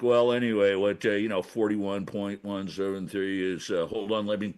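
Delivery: imitating a droning tax accountant; dull tax guy. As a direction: drone like a number-crunching tax guy. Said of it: "they're like".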